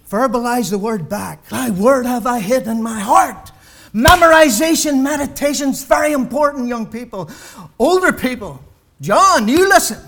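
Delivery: pulpit-pounding sermon climax; full-force preaching. Real, not acted. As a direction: preach it out with rising fire to the congregation. Preacher fires up again.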